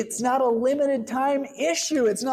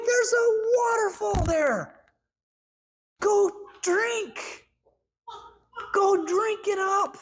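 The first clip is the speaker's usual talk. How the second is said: much higher, eager invitation; exuberant God; 8 semitones higher than usual. Retold or imitated. God urging a thirsty believer, higher and exuberant. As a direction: point them to the waterfall, delighted. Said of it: "He's like".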